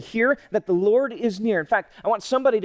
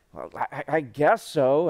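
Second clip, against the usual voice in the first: acted halfhearted yes; reluctant agreement; 7 semitones lower than usual. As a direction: drag it out unwillingly, dropping low. Reluctant person asked to pray.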